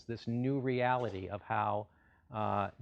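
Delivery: ordinary talk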